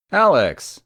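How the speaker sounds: calling his name out high and loud; a bright, loud hello across the room; loud greeting. Acted. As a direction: hail him warmly and loudly. Greeting injured friend in hospital.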